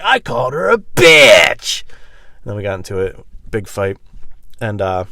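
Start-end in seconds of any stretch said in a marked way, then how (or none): 0.0-1.8 slow, loud defiant shout. Retold or imitated. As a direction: spit it back slowly and loud.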